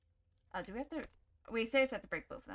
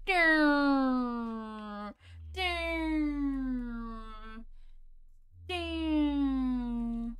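long ringing ding sliding down in pitch; imitated ding; 2 semitones higher, much slower than usual. Player mimicking an unwelcome noise, imitated ding. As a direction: draw out each ding and let it fall. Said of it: "I don't like us going".

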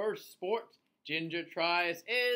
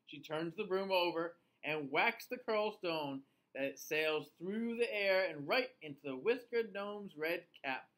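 usual speaking voice

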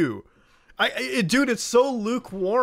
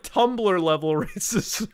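normal talking voice

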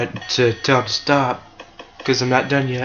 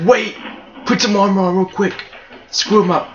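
raised-pitch quick order from an imagined enemy; mock urgency; 6 semitones higher than usual. Game enemy scrambling to trap the player, mock urgency. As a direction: act out an enemy's urgent call.

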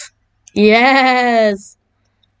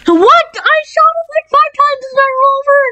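very high, fast indignant squeal; outraged disbelief. Real, not acted. Gamer who shot an enemy five times, outraged disbelief. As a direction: shriek it in disbelief, rushing the end.

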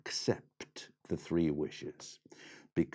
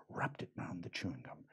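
ordinary delivery

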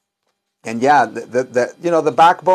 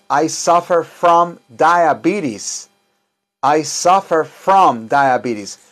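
slow model sentence; slower than usual. Shown as a demonstration. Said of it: "slow model sentence for class".

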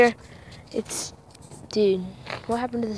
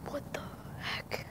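scared whisper; hushed, frightened whisper; nervous night vlog whisper; 22 dB quieter than usual. Real, not acted. Scared at night, spinning tire.

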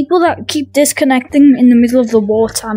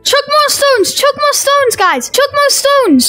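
excited calling; 9 semitones higher, slower than usual. Real, not acted. Urging players to throw.

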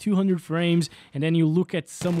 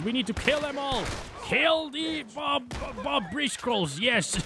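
battle cry; 8 semitones higher, 3 dB quieter than usual. Real, not acted. Fighting enemies in the game.

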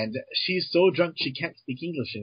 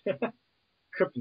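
much higher and slow, laughing as you say Kryptonite; laughing punchline; 6 semitones higher, 4 dB quieter, much slower than usual. Real, not acted. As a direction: stretch it out through laughter. Laughing, drawn-out, high punchline.

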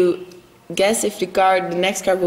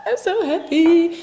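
high-pitched, excited squeal of delight; acting out delighted surprise, pitch leaping up; overjoyed reaction; 12 semitones higher, slower than usual. Vlogger retelling her reaction to supermarket news, excited. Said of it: "I was like".

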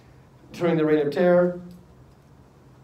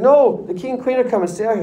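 loud, high acted insistence; insistent protest; 6 semitones higher, 6 dB louder than usual. Boy urging friends to stay in rain, insistent. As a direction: overrule them, raised voice and pitch. Brendan overrules friend wanting to leave.

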